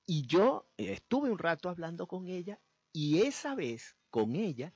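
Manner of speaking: normal talking voice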